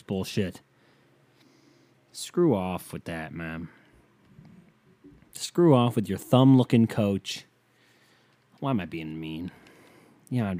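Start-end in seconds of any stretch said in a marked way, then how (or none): none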